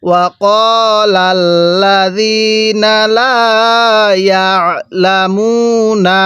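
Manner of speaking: ওয়াকালাল্লাযীনা লা drawn out, long held vowels; light throat pressure on the ain of ইয়ালামুনা; measured tajweed model of ওয়াকালাল্লাযীনা লা ইয়ালামুনা; slow joined recitation. Shown as a demonstration. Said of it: "গলার মধ্যে হালকা চাপ দিয়ে পড়বেন".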